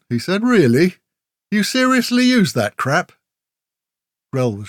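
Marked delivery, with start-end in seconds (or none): 0.4-3.1 high, loud incredulous mockery; mocking. A narrator's reading. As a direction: scoff at his gear in disbelief. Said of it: "This little old geezer mocking his gear".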